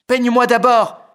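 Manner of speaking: big brother demanding to be combed, loud high cry. Acted. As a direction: shout it first, a pushy big brother. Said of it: "crie grand frère Félix".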